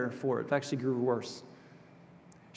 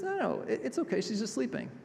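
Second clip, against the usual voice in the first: much higher, airy, playful reassurance; light reassuring Jesus; 9 semitones higher than usual. Retold or imitated. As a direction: brush it off lightly, voice up high. Jesus gently reassuring the mourners.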